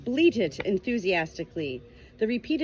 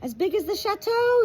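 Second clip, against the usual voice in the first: much higher, louder triumphant cry; 13 semitones higher, 5 dB louder than usual. Acted. Cloud announcing the answer to the flock, triumphant and high. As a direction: crow it out in victory. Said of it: "said Cloud triumphantly".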